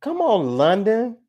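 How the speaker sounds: high, loud call. Real, not acted. Reader urges London on, calling out high and loud. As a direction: call out, pitched well up.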